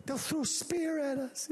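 high, nervous voice from the back; hammed-up timid worrier, pitched high. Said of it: "Joe at the back is more timid".

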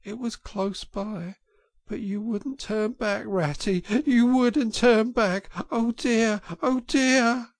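high, broken, sobbing voice with stammers; sobbing brokenly. A narrator's reading. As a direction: sob out the words, choking on them. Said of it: "he sobbed forth at last brokenly".

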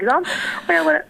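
normal talking voice

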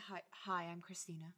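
low, soft sultry radio host. Shown as a demonstration. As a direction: purr it into the mic like late-night radio. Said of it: "this is my sultry radio voice".

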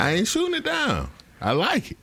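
high defensive voice. Acted.

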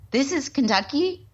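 high, rising incredulous question; retold disbelief. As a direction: act out your surprise, pitch climbing. Retelling surprise at a liberal town.

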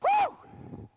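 high, ringing whoop of delight; joyful whoop. Real, not acted. Preacher whoops to the congregation, high and exultant. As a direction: let out a whoop of joy.

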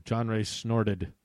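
usual speaking voice

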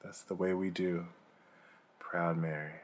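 low, very soft title announcement; hushed lead-in to a song; spoken song intro. Acted. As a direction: murmur it like a stage intro. Ends Proud Mary spoken intro.

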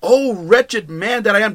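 a lightly voiced cry of misery; despairing cry. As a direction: say it like a man at the end of himself. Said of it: "he was in a terrible state here".

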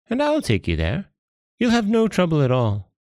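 high-pitched, coaxing jellyfish voice; eager jellyfish. Acted. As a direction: coax the monkey in a high little creature voice.

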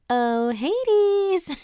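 high, sing-song ditzy goddess voice; sing-songy ditzy voice. Pretend. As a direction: sweetly coo his name like an airhead. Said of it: "a sing-songy voice".

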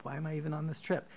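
usual speaking voice

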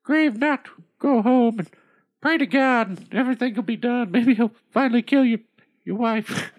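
goldfish voice. Acted.

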